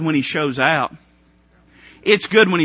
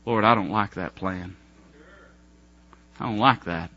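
grumbling prayer; 8 semitones lower, 6 dB quieter than usual. Retold or imitated. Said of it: "Or what about this?".

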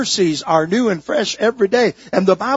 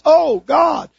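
higher, louder cry to God; 9 semitones higher, 5 dB louder than usual. Acted. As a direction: cry out in prayer, voice lifted. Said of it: "If she just cries".

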